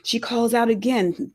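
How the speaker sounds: ordinary delivery, nothing marked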